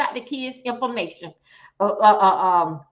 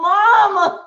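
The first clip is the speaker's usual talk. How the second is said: high, loud imitation of a child calling mama; a child's mock wail for her mother; mock cry for mama; 6 semitones higher, 8 dB louder than usual. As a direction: call out for mama like a hurt kid. Mimics the crawling child calling.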